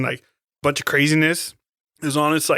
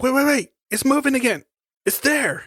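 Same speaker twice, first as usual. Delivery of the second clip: urgent warning; 11 semitones higher than usual. Retold or imitated. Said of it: "And they're like".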